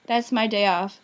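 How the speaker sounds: usual speaking voice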